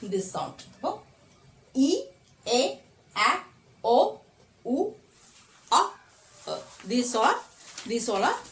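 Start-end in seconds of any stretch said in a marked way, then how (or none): 1.9-6.6 each vowel short and abrupt. Shown as a demonstration. Teacher listing the short vowels, short and abrupt. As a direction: cut each vowel off quickly, pausing between them. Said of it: "short vowels are the vowel sounds which are pronounced abruptly".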